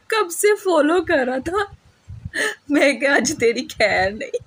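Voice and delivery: high, squeaky words squeezed through real laughter; laughing. Real, not acted. Vlogger recounting the beating with a thick stick, laughing. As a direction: try to tell it while cracking up. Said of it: "मैं क्यों हंस रही हूँ".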